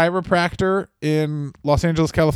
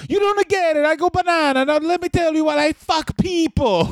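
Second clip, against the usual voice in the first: much higher hammed-up Italian accent; 12 semitones higher than usual. Cohost pushing the joke in a hammed-up Italian accent. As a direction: go big with a silly Italian accent. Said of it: "That's a really good Italian accent".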